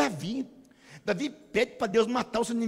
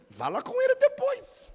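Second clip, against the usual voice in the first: laughing. Real, not acted. Pastor teasing the congregation while laughing. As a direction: laugh as you tease.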